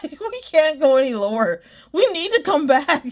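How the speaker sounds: rally everyone in mock despair; amused imitation of frantic organizers; mock desperate plea. Acts out desperate halftime organizers.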